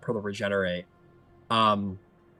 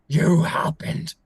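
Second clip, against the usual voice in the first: slow, forceful breath-heavy hiss; loud whispered fury; seething accusation; 6 semitones higher, 7 dB louder, slower than usual. Acted. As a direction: repeat the blame slowly through harsh breath. Repeats accusation, hoarse and slow.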